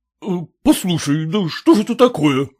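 indignant protest from the husband; understated indignation. A narrator's reading. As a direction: object with mild outrage. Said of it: "возмутился муж".

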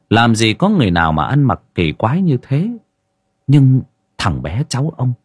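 plain reading voice

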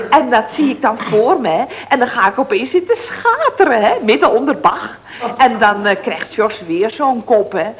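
ordinary talk

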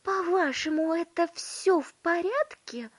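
high, loud outraged question; indignant mother. A narrator's reading. Mother rebuking Wonka over her tiny son, indignant. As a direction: throw it back in indignation. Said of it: "возмутилась миссис Тиви".

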